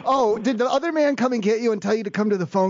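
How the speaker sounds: ordinary talk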